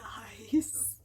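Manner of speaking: breathless excited whisper; hushed, thrilled whisper. Retold or imitated. Retold whisper on getting message.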